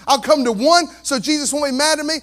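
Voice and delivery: ordinary delivery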